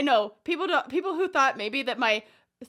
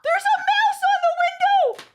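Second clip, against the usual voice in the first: high, loud real panic shriek at a mouse; frightened scream; 6 dB louder than usual. Real, not acted. Sees a mouse, phobia.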